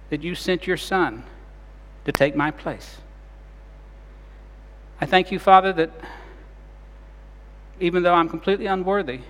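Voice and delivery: closing prayer. Acted. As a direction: pray it gently on behalf of everyone.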